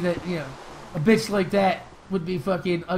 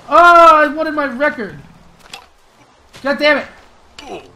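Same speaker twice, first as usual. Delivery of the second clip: frustrated yell; 6 semitones higher, 11 dB louder, slower than usual. Real, not acted. Loses his record in the fight.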